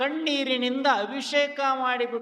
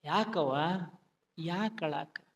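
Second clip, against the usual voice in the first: gentle asking; 6 semitones lower, 7 dB quieter than usual. Acted. Goddess asks weeping widow.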